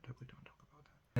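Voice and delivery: fast secretive whisper; hushed quick aside. Real, not acted.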